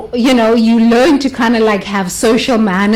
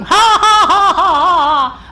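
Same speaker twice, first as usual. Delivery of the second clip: church laughter; 9 semitones higher, slower than usual. Shown as a demonstration. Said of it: "start laughing".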